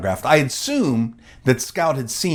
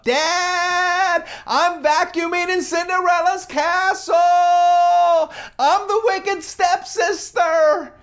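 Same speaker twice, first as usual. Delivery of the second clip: put-on high child calling across a room; child yelling; slower than usual. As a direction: call it out high and thrilled. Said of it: "she yells at me across the restaurant".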